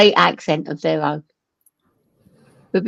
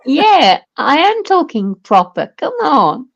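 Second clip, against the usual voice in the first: louder, higher, broad Lancashire insistence; Lancashire accent; 7 semitones higher, 4 dB louder than usual. Retold or imitated. [name] to friends on the phone, protesting in broad Lancashire. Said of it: "I'm like".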